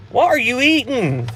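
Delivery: high, incredulous question; suddenly noticing someone eating; surprised. Real, not acted. Hears friend eating on stream.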